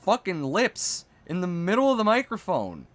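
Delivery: high, loud exasperated rant; angry rant. Acted. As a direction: rant at him, fed up and shrill. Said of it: "I play them up a little bit".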